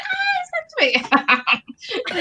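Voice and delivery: high, slow, dreamy squeal; smitten swooning. Acted. Fan thrilled by Michael's reply, high smitten swoon. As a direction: swoon it with a giggle.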